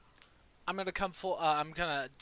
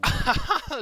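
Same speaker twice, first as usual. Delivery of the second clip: gleeful high laugh; 13 semitones higher, 12 dB louder than usual. Real, not acted. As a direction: burst of high triumphant laughter. Gleeful laugh after reveal.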